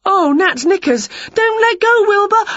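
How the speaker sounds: high, loud alarm building to a long cry; panicked shout as you lose balance; panicking. A narrator's reading. Witch about to fall off ladder.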